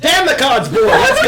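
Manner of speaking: high laughing yell; loud shout. Pretend. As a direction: yell it to hurry them.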